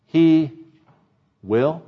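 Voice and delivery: "he", long pause, then "will" set apart; stress on WILL. Shown as a demonstration. Preacher contrasting Samuel's faith with the people's, isolated "will". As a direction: pause before "will" and let it stand alone. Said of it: "What does he say at the end of verse three".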